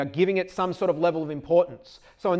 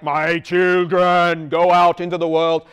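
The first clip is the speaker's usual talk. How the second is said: divine voice; 12 dB louder, slower than usual. Shown as a demonstration. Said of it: "almost like this divine sort of like".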